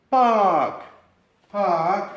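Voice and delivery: park with a long, stretched a and silent r; exaggerated long vowel. Shown as a demonstration. Teacher exaggerating park for the class. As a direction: drag out the a in park. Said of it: "Lo estoy exagerando para que lo veáis".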